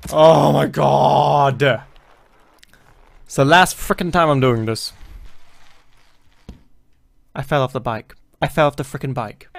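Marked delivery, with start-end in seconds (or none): none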